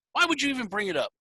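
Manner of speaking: fast, high-pitched disbelief; retold exasperation. Fan let down by the band's teasing, retold exasperation. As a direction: complain in quick, baffled protest.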